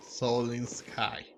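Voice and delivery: ordinary talk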